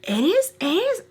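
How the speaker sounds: high, squeaky fake astonishment; rising high-pitched disbelief; mock surprise. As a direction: act amazed by the obvious. Feigned surprise at question.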